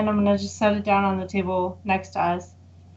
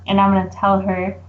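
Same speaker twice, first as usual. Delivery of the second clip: ordinary talk, nothing marked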